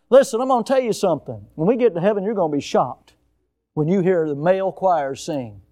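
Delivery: ordinary delivery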